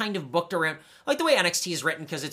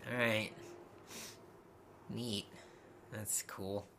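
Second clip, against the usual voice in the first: deadpan sarcasm; 8 semitones lower, 18 dB quieter, slower than usual. Real, not acted. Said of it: "sarcastic after roster".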